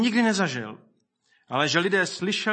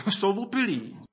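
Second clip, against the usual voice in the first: amused, higher-pitched jeer with a chuckle; mocking; 4 semitones higher than usual. Scoffers at Pentecost dismissing the believers, mocking. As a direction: lightly mimic a scoffer laughing it off. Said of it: "s posměškem říkali".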